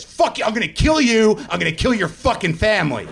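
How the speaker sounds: high-pitched, threatening mock outburst; violent rage. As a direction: rage like a friend demonstrating his violent temper. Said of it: "I go to this".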